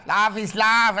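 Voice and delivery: hammed-up London "lav is lav"; London accent. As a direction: say "love is love" as a put-on Londoner. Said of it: "meant to be a London accent".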